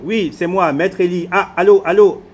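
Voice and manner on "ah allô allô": louder, higher hello over a bad line; calling into phone. Real, not acted. Man losing the signal, calling allô loudly. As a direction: push the voice to be heard on the phone.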